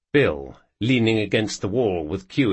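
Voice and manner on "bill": plain reading voice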